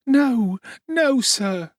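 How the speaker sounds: put-on high, tearful pleading child; a frightened orphan sobbing to the beadle. A narrator's reading. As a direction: beg through tears like a small boy. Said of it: "sobbed Oliver".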